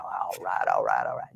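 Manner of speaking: low drawled all right all right; a hint of Matthew McConaughey catchphrase. Shown as a demonstration. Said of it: "I threw a little Matthew McConaughey in there".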